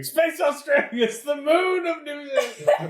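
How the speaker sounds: put-on high, laughing New Zealand accent yell; New Zealand accent screaming. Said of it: "wish I could do a New Zealand accent and just start screaming".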